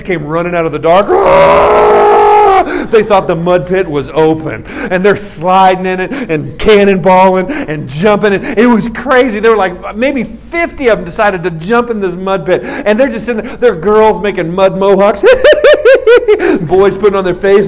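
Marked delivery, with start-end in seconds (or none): 1.1-2.6 loud, unvoiced zombie-horde roar; mob roar. Imitates kids charging out of dark. 15.3-16.6 high, loud mock giggle; girlish squeal. Imitates girls playing in mud.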